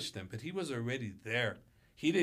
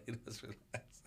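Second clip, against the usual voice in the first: whisper; 13 dB quieter than usual. Real, not acted. Quiet aside after laugh.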